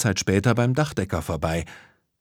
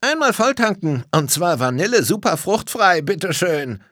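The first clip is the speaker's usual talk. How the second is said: put-on high, loud little boy's voice; raised boyish voice, easing at the end; little chubby boy; 9 semitones higher, 4 dB louder than usual. A narrator's reading. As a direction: order like an eager small boy. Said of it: "Ein kleiner, dicker Junge".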